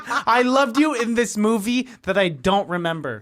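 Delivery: imitating a clueless fan; high, loud, lightly mocking quote; mock fan gush. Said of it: "starting a sentence by saying".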